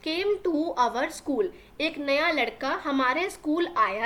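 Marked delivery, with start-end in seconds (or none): 0.1-1.5 very slow, word by word for learners; slow classroom dictation reading; slow read-aloud. Shown as a demonstration. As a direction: spell the sentence out slowly for the class. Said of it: "teacher reads the line".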